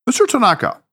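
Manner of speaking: Mister pitched high, then a cool falling address; "Mister Tanaka" said formally, composed and even; businesslike address, a hint of strain; neutral professional tone. A narrator's reading. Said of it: "his most neutral professional tone".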